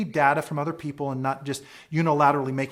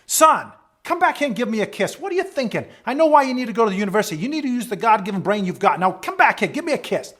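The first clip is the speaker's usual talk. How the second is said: high, loud, rapid-fire fatherly scolding; imitation of a bossy, exasperated dad; hollering dad; 8 semitones higher, 6 dB louder, faster than usual. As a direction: call your son back, loud and insistent. Father hollers at departing son.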